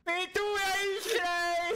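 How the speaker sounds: high, drawn-out mock cry of love; hammed-up declaration. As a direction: stretch it out, melodramatic and high-pitched. Erklærer kjærlighet under drapsjakt. (overdrevent, langtrukkent kjærlighetsrop)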